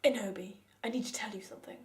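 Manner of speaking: low, grave Hagrid voice dropping to a whisper; serious low voice. Acted. Hagrid quietly telling Ebony something serious, low and grave. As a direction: lean in and confide it gravely, ending hushed. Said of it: "he said in a V serious voice".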